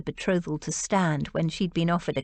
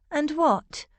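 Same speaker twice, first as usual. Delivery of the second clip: a pushy, higher-pitched prod for more; impatient; 8 semitones higher than usual. A narrator's reading. Rose pressing George to finish, a touch impatient. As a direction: hurry her along, impatiently. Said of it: "Rose asked impatiently".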